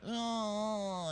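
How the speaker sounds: high, soft put-on whimper; mock crying. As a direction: imitate a girlfriend starting to cry. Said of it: "and cry".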